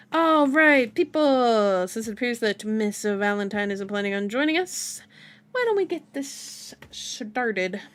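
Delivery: smooth-voiced leader cutting in over the chatter; Wesker. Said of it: "cut short by Wesker's smooth voice".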